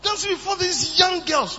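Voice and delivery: normal talking voice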